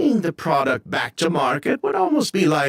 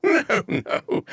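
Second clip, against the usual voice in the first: light laugh through a breezy denial; 12 semitones higher than usual. Acted. Perfumer laughing off the poison question, amused dismissal. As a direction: chuckle away the silly idea.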